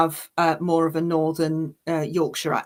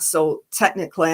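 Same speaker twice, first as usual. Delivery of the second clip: ordinary talk, nothing marked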